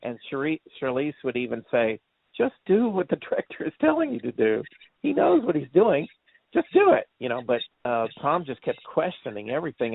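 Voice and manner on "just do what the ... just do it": high-pitched retold pleading with a co-star; exasperated urging. Charlize telling Tom to obey the director, imitated exasperation. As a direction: imitate her urging him, pitch climbing to the end.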